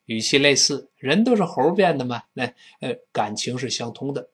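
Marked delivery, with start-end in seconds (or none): none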